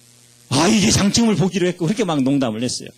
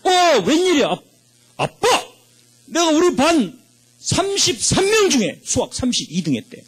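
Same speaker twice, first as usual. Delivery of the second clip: put-on much higher, excited boy bursting in with news; excited son; 11 semitones higher than usual. Son rushing in to tell dad his math rank, excited and high. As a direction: blurt it like a kid proud of his rank.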